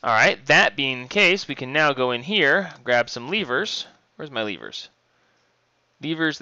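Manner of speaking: usual speaking voice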